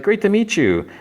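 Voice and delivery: high, gushing hello; bubbly greeting. Retold or imitated. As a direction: greet him like a delighted hostess. Joy greets Harrison warmly.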